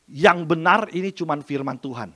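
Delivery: usual speaking voice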